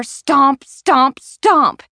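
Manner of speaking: each "stomp" punched loud and separate; imitated stomping. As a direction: hit "stomp, stomp. stomp." like heavy footfalls. Imitating neighbours' footsteps on roof.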